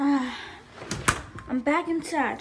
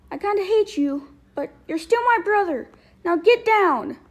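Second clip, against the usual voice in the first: ordinary talk, nothing marked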